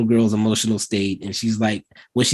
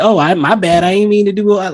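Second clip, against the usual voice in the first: high, loud comeback in a doll's role; raised-voice imitation of a snippy apology; sassy mock apology; 8 semitones higher, 5 dB louder, faster than usual. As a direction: play a doll answering back. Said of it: "And Megan's like".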